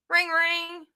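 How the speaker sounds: high, sing-song ring ring; phone ring imitation. Streamer teaching a friend to phone, ring ring sung high. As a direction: mimic a ringing phone high.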